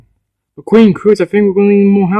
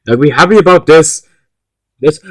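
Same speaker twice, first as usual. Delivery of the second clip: normal talking voice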